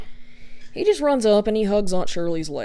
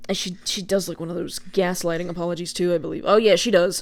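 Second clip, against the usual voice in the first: ordinary talk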